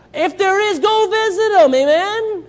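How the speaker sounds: loud, high preacher's exhortation; emphatic call-out. Real, not acted. Preacher urging the congregation to visit missionaries, loud call-out. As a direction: shout it out to the congregation.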